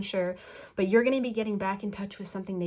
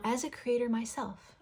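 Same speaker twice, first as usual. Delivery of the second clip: ordinary talk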